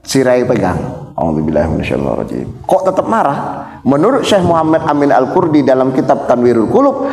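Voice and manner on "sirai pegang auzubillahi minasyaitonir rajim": low, fast murmured prayer; quick ta'awudz. Shown as a demonstration. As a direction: rattle off the ta'awudz under your breath. (ta'awudz cepat dan lirih)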